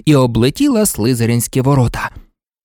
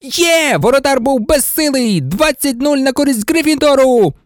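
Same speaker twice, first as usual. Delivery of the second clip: high-pitched goal celebration; thrilled sportscaster's cry; jubilant commentator; 9 semitones higher than usual. Acted. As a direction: announce the score in triumph.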